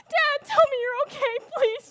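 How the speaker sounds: high, loud begging; loud desperate pleading. Acted. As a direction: beg him loudly to answer. Father in crisis.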